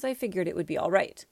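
usual speaking voice

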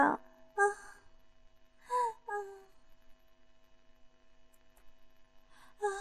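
soft, spaced-out moans; breathy moans with long gaps; acted moaning. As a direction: sigh softly in pleasure. Acted moans within the story.

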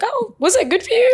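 high-pitched, put-on casual question; mock casual. Client asking after a vanilla session, breezily casual. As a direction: imitate an offhand request for validation. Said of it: "they ask so casually".

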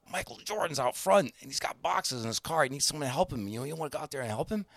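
high, hushed, urgent tip; excited news-breaking. Retold or imitated. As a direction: imitate staff pleading with the boss. Staff break news to manager.

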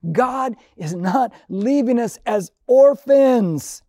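usual speaking voice

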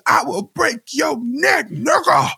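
high-pitched imitation of an angry father; mock threat. Cohost quotes the father's threat to his son, high slow mock rage. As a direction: squeal the threat slowly, word by word. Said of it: "He said".

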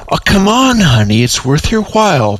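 high, coaxing plea; pleading. Acted. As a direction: wheedle her into it. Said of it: "pleaded Demarley".

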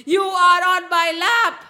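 high, very loud declaration; triumphant shout. A narrator's reading. Narasimha dooms the demon on his lap, triumphant shout. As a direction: shout the final verdict.

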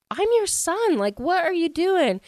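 high-pitched mock disbelief; incredulous. Son confronting his smug dad, imitated incredulous protest.

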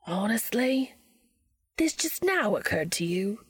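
theatrically exasperated English voice; dramatic exasperation. A narrator's reading. Crowley reacts to Dean's late realization, dramatically exasperated. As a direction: sigh it out with a flourish. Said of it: "Crowley sighed dramatically".

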